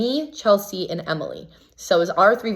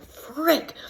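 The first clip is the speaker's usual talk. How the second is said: high-pitched mock frustrated "frick"; dismayed squeal; 10 semitones higher than usual. Contestant reacting to a bad veto result, high dismay. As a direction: let the word jump up high in dismay.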